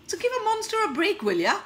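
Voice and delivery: high, loud, fast monster plea; Godzilla. Acted. Godzilla begging a break for a monster, loud exasperated plea. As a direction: burst out high and quick, then drop on the last words. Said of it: "I have to become Godzilla".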